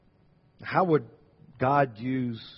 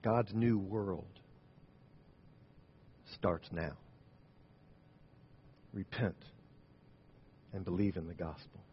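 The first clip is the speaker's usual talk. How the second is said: hushed closing; 4 semitones lower, 11 dB quieter than usual. Acted. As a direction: lay it down gently as a call to prayer. Quiet closing call to prayer.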